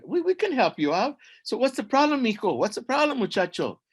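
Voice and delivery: high, lively voice of a friendly ant; eager helper ants. Acted. Ants offering the hero help, eager and high-pitched. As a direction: pipe up, keen to help.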